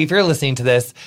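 usual speaking voice

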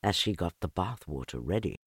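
plain reading voice

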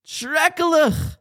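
imitated grandmother's cry of dismay; screamed-out alarm. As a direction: wail it like a panicked bubby. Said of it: "and she screamed out".

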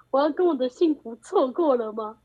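slow, hammed-up tearful whine; mock lament. Asking friends if she missed her happiness, slow mock-tearful whine. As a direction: play it as overdone heartbreak.

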